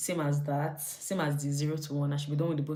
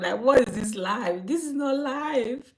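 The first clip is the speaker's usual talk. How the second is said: slow, high wailing complaint; mock despair; 8 semitones higher, 4 dB louder, slower than usual. Cook lamenting how fast food finishes, mock despair. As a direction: draw it out in hammed-up misery.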